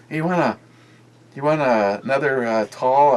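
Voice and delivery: put-on high voice for the friend's question; imitating a friend. Friend's second offer acted out.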